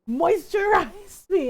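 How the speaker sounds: high, loud, drawn-out demand; hammed-up imperious plea, laughing midway; Cassandra. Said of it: "she was always like".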